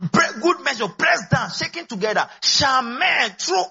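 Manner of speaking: fervent preaching. Real, not acted. Preacher quoting a promise to the congregation, fervent build to a shout. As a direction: proclaim the promise with rising fervour.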